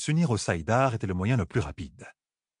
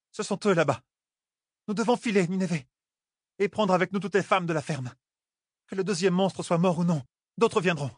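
urgent warning; 9 semitones higher than usual. Acted. Nineveh urging everyone to leave, raised voice. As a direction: urge them to flee.

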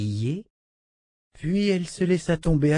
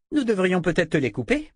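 bratty princess; 7 semitones higher than usual. Acted. Spoiled princess threatens.

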